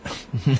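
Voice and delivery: nervous laugh. A narrator's reading. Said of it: "Temin laughs nervously".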